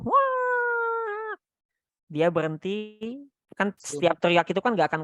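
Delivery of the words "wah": mock tantrum yell, much higher; imitated toddler scream. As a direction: play the child shrieking mid-tantrum. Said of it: "Nanti dia teriak lagi tuh". (meniru teriakan anak tantrum)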